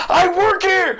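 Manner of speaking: high, loud indignant yell; hammed-up cry of innocent protest; protesting bartender. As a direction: yell it as the wrongly cuffed bartender. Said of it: "And the bartender's like".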